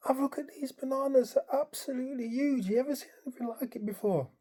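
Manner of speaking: high mock shout, no real volume; imitated holler in a high, strained-up pitch; retold excited shouting. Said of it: "so I'm shouting across the room".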